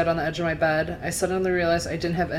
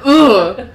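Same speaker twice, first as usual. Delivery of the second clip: loud, higher-pitched "ugh"; disgusted groan; 6 semitones higher, 13 dB louder than usual. Acted. Mother sees the boyfriend still there, disgusted groan. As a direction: groan a big exasperated ugh.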